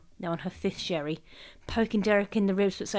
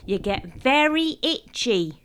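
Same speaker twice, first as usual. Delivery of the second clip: loud, slow, drawn-out call; 4 semitones higher, 5 dB louder, slower than usual. A narrator's reading. Mum calling over the adults to the children, loud. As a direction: call it over everyone's heads. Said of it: "she called over our heads".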